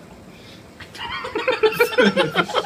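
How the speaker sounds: loud, high burst of real laughter; real high-pitched laughter; high cackling laugh. Real, not acted. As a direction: crack up at your own punchline. Teller cracks up at own joke.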